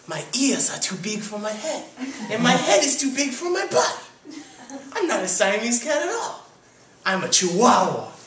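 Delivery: put-on high-pitched playful Spanish accent; hammed-up Spanish accent, pitched high. A narrator's reading. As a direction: kitten putting on his best Spanish accent. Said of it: "in his best Spanish accent".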